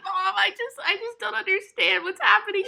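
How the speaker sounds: high, bewildered weeping; hammed-up weepy plea to mom; mock crying. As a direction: imitate someone drugged and tearfully confused. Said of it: "I was hysterically crying".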